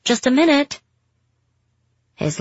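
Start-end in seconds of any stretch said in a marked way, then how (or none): 0.0-0.8 high, loud call through a door; yelling. Acted. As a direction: shout it toward the door. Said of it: "I yelled".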